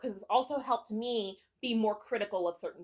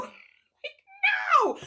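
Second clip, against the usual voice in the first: excited shriek; 5 dB louder than usual. Real, not acted. Overjoyed reaction to the confession.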